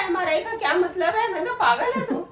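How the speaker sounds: high, laughing scold; laughing mockery. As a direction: laugh out the retort.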